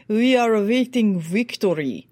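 put-on Russian accent with a crisp, distinct v. Coach contrasting an Eastern Russian-accented "We are awaiting victory". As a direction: imitate a Russian speaker, hard v sounds. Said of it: "Russian who will say".

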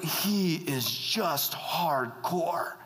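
slow, drawn-out admiration; lingering, impressed verdict; awed emphasis. Retold or imitated. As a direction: stretch each word out in awe. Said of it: "who everyone's like".

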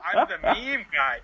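mock anguish breaking into laughter, loud; laughing despair. Imagining his tombstone, laughing mock despair. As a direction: wail it through a laugh. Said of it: "And I was like".

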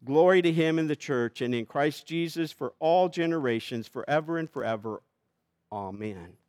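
scripture reading. Acted. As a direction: proclaim it like a blessing. Reads Paul's doxology aloud.

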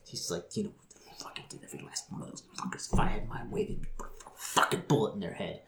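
low curse sinking into a whisper; whispered menace, all voice drained out; seething whisper. Acted. Woman wishing death on those she blames, seething whisper. Seething threat in acted rant.

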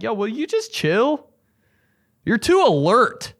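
high-pitched plea to an overalert dog; mock exasperation. Pleading with tense dogs.

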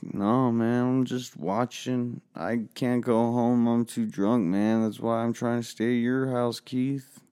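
put-on high, slow, whiny drunk plea; drunk pleading. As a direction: beg like a wasted teen. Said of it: "Billy was just like".

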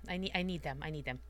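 low, hushed murmur to oneself; private, fading insistence; quiet self-talk. Acted. Character reassuring herself, voice drops.

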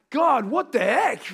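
tired dad's exasperated outburst; exasperated cry. Retold or imitated. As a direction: throw up your hands at God. Exasperated at God.

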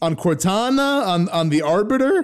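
loud, high mock indignation; mock put-upon. Host pressed for opinions on Halo lore, mock put-upon. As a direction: play up the exasperation, pitched high.

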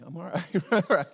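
laughing it off loudly; forced laugh. Real, not acted. Pastor insisting he's fine, laughing it off. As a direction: brush it off with a loud laugh.

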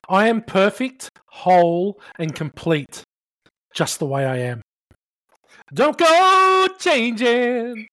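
slow mantra, last line crooned high; affirmation recital. Pretend. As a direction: recite it, then croon the end.

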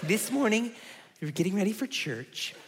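lightly voiced mom, soft and halting; confiding mom voice. Mom telling a lunch story, lightly imitated confiding voice. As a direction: imitate a mother softly launching into her tale. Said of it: "She's like".

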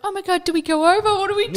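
high, fast flustered panic; panicked. Retold or imitated. Overhearing the sad neighbour kid, high fast panicked fluster. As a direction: rush it like you don't know what to do. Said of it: "I was like".

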